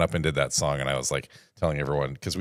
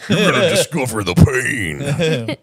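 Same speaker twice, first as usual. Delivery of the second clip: slow raspy wrestler growl; wrestler promo; 8 semitones higher, 7 dB louder, slower than usual. Pretend. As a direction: menace them like a wrestler.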